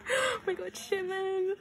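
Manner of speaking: high-pitched fangirl squeal; excited squeal. Real, not acted. Fan reacting to a [name] photo card, excited squeal.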